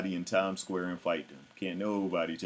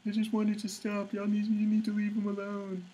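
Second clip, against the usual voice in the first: mocking his fans; 14 semitones higher than usual. Imitating audience members telling him to back off, squeaky high mock. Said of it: "their audience members coming at me talking some dumb shit".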